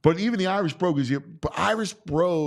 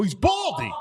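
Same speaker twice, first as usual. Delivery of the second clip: mock defensive; 8 semitones higher than usual. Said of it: "I go".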